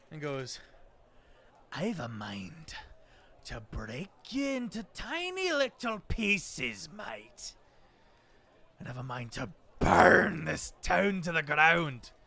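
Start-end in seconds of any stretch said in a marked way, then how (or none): 1.7-7.5 menacing threat. Acted. Dwarf lord threatening Somber, menacing. 8.8-12.1 very loud, threatening roar; furious roar. Acted. Dwarf lord roaring his threat at the town.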